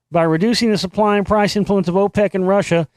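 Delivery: ordinary delivery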